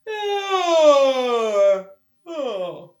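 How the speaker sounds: loud drawn-out waking yawn; big yawn. Acted. Mommy bear waking in the cave, loud yawn.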